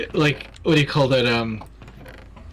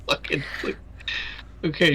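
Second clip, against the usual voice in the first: ordinary delivery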